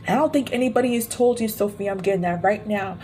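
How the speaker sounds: ordinary delivery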